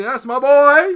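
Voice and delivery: loud, high, hammed-up cheer; proud dad. Dad patting his son's head, loud hammed-up praise. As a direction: call it out big and high. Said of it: "and you say".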